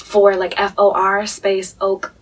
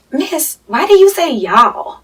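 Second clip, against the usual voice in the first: higher, louder, drawn-out mock kid question; imitating schoolkids; 7 semitones higher, 7 dB louder, much slower than usual. Kids at school asking about her y'all, mock childlike voice. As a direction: slowly wail it like a puzzled pupil. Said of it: "all the kids are like".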